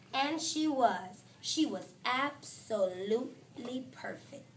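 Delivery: plain reading voice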